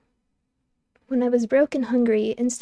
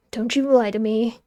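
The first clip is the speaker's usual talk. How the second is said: ordinary delivery, nothing marked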